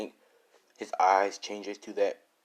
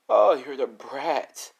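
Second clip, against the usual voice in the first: higher, louder and very slow mocking drawl; sneering thug; 5 semitones higher, 7 dB louder, much slower than usual. Thug mocking the kid he recognizes, slow sneer. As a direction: stretch it out, looking down on him.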